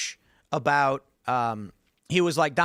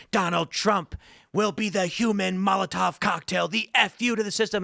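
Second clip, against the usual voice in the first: mock-epic speech, pitched up; Michael Moore; 5 semitones higher than usual. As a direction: declaim it like a dramatic viral clip. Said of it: "It's all epic, and Michael Moore is like".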